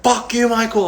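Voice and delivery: hammed-up cursing outburst; mock angry scream. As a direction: imitate someone told to scream mad. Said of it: "Just, like, you know, scream".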